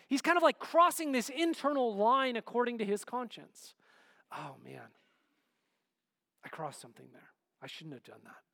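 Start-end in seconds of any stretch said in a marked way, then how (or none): 4.3-8.4 soft, low, regretful inner voice; guilty murmur. Acted. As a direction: mutter it to yourself, ashamed. David's guilty inner thought.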